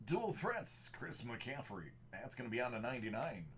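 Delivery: big movie-trailer announcer read; projected movie voice. Acted. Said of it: "projecting the movie voice".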